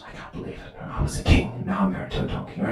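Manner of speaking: hushed, voiceless grumbling to oneself; muttered inner thoughts. Acted. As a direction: whisper it like a stewing private thought. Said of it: "Like this, they're thinking".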